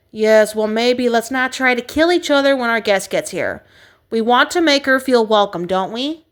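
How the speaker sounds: plain reading voice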